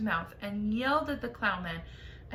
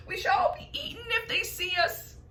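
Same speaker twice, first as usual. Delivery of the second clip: fearful earthworm; 11 semitones higher than usual. A narrator's reading.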